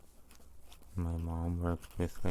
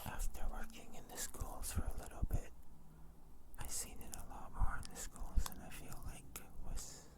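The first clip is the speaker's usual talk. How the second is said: soft close-mic whisper; breathy ASMR whisper, barely voiced; tingly asmr mouth sounds; 8 dB quieter than usual. Long whispered ASMR ramble.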